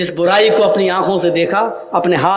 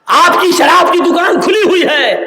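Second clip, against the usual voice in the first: accusing shout; 8 semitones higher, 5 dB louder than usual. Real, not acted. Preacher accusing congregation.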